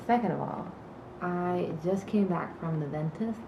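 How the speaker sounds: normal talking voice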